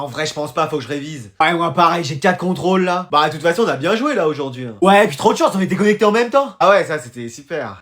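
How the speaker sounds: ordinary talk, nothing marked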